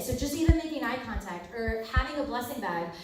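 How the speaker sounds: ordinary talk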